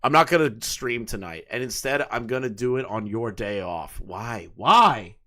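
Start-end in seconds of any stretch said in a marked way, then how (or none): none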